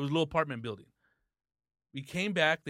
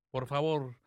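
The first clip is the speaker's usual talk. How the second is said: grand entrance announcement; 2 semitones lower than usual. Acted. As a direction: welcome the star like a boxing MC. Introducing the guest.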